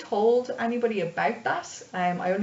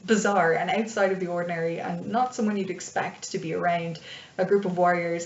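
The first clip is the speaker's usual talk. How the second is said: ordinary talk, nothing marked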